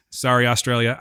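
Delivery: usual speaking voice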